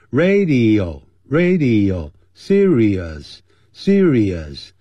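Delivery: "Radio" and "serious" very slow, each syllable full; clear careful pronunciation. Shown as a demonstration. Teacher modelling "radio" and "serious" slowly for students. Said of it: "每一个音节都要发得很漂亮".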